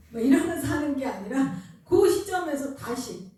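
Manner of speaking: normal talking voice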